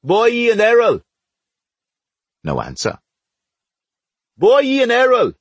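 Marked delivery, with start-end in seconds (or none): 0.0-1.0 high, loud street call; long-range summoning shout. Acted. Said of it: "We heard Hat shouting in the street". 4.4-5.3 louder, very high street holler; full-voiced calling out; shouting. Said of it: "We heard Hat shouting in the street".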